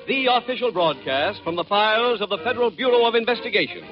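ordinary talk

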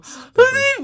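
put-on very high squeaky baby-voice "Ay"; baby talk. Guy showing how he talks to his boyfriend, high baby voice. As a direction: coo like a baby at your boyfriend. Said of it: "yo hablo como un bebé".

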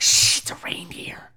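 hushed, slow whisper; whispering shush. Retold or imitated. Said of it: "I'm like".

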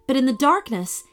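usual speaking voice

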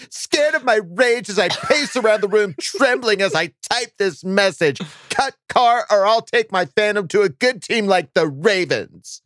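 put-on high whiny Valley Girl voice. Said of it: "the whiny girl voice".

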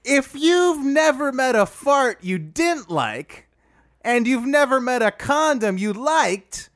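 Dwight Howard voice. Acted. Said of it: "can we dial that accent up a little".

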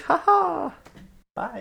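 high gleeful cackle then bye; gleeful old lady. Retold or imitated. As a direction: toss it away with a laugh. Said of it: "She's like".

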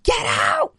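sharp high shout of "get out"; retold shout. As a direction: throw him out with a shout. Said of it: "And I'm like".

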